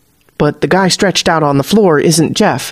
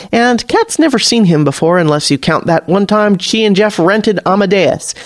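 usual speaking voice

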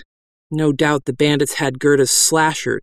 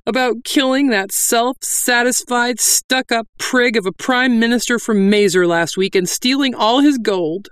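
weepy bandit; 9 semitones higher than usual. Acted. Bandit confessing tearfully.

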